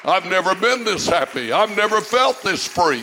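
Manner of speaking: climbs from loud to a high, full shout; building to a shout. Acted. Pastor rejoicing before the church, building to a shout. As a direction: build it up till you shout it.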